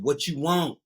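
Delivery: with an attitude. Retold or imitated. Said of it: "with an attitude".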